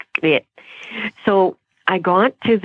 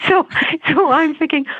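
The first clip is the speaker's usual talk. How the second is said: normal talking voice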